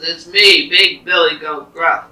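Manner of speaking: loud, bold big-goat voice; big billy goat. Acted. Big goat answers the troll, loud and bold. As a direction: announce it forcefully at full volume.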